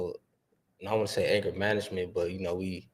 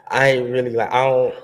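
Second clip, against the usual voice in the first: laughing; 4 semitones higher, 9 dB louder than usual. Real, not acted. Laughing while admitting.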